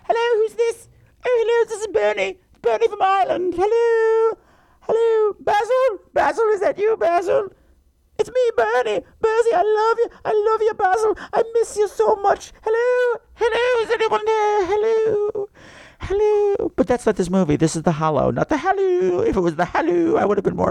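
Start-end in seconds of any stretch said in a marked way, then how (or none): 0.0-16.7 squeaky high falsetto Irish cousin; really high voice. Acted. Friend's imitation of Irish cousin answering phone, really high voice. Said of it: "she had this really high voice". 18.5-19.2 high falsetto. Shown as a demonstration. Podcaster echoing cousin's hello, high falsetto HOLLOW. 19.5-20.2 high falsetto. Shown as a demonstration. Podcaster joking about film title, high squeaky HOLLOW. Echoes [name]'s high hello.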